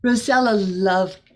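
ordinary delivery, nothing marked

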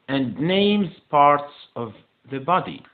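slow English, word by word; slow model reading. Shown as a demonstration. Teacher repeating the task for pupils, slow model reading. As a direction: repeat it slowly for learners.

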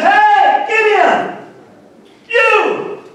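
high, ringing call with pauses between phrases; preacher imitating an angel calling out; angel's yelled hail. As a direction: hail someone from above, acting it out. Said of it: "I believe he had to yell".

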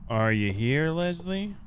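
ordinary talk, nothing marked

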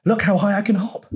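quick, high show-off frog; teasing boast. A narrator's reading. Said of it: "teased Frog".

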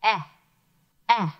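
Eh, eh repeated as an isolated short E; short e sound. Shown as a demonstration. Teacher isolating the short e sound. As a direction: clip the vowel Eh twice. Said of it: "is making a short E sound".

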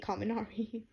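ordinary delivery, nothing marked

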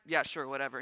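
low, careless mock reply; offhand dismissal. Imagined offhand reply from Jesus.

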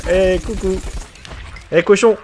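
high, loud cheeky jeers; taunting. Real, not acted. Streamer jeering at a beaten enemy, cheeky and loud. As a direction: gloat at the enemy you just hit.

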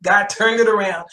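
normal talking voice

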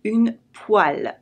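"Une poêle" said slowly, /yn pwal/; slow, careful teaching of a French word; slow model word. Shown as a demonstration. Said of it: "It comes from the word pan".

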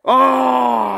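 high loud cry of delight; excited cry. Real, not acted. Angler seeing his friend's big trout, delighted cry. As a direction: shout out at the sight of the fish.

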